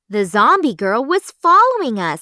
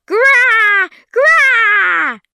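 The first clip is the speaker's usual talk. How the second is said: much higher, loud zombie shriek; furious zombie scream; 13 semitones higher, 8 dB louder than usual. A narrator's reading. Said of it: "she screamed".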